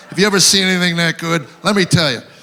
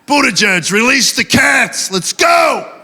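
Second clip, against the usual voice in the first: rising hammed-up shout, higher and louder; mock shout; 6 semitones higher, 3 dB louder, slower than usual. Comic begging the transport secretary, hammed-up shout. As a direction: bark the order like a general.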